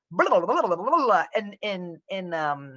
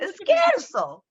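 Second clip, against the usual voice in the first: "It's scherzo" said slow and much higher, stretching "scherzo"; drawn-out high quote; 11 semitones higher, 3 dB louder, slower than usual. Retold or imitated. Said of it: "mock retelling of Italian joke".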